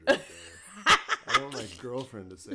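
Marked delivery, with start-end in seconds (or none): 0.1-1.0 laughing. Retold or imitated. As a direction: voice a stranger while laughing. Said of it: "to be like".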